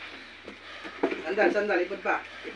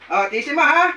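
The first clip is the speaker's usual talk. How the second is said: normal talking voice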